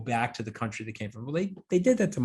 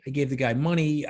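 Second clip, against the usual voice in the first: usual speaking voice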